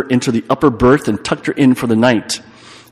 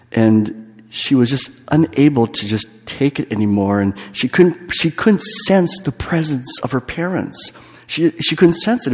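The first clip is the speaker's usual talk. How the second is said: usual speaking voice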